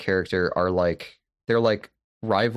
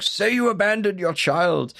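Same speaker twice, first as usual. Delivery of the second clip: forceful preacher's demand; 10 semitones higher than usual. Retold or imitated. Host imitating preacher pressing Daniel to confess, forceful high yell.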